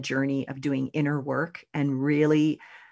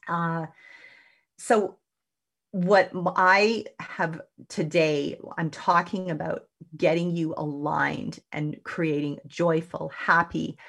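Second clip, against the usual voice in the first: ordinary delivery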